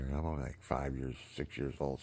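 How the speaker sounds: usual speaking voice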